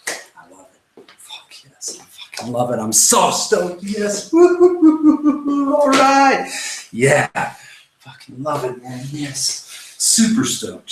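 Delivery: acted hype, from a whisper up to high whoops; stoked adventure mindset. Shown as a demonstration. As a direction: psych yourself up in a demo of being stoked. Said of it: "switch it with the adventure mindset".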